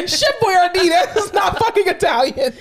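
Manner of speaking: high, laughing mock outrage; amused protest. Coworker objecting to fake Italian words, high amused protest.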